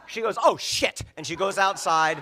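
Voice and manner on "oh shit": loud, high exclamation; alarmed realization. Retold or imitated. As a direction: blurt it out in sudden alarm. Mom's sudden realization.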